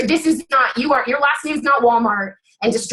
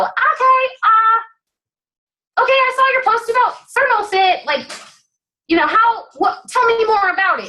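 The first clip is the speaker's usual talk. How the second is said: higher, eager customer asking; curious prospect voice; 9 semitones higher than usual. Retold or imitated. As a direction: play an interested buyer asking for more. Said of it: "It's usually what I get".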